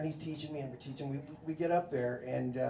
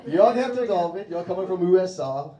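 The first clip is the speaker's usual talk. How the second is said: put-on louder, higher proud recital with American accent; American-accented Swedish; 4 semitones higher, 6 dB louder than usual. American proudly reciting rude Swedish to the wife's family, louder American accent. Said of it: "All right, here we go".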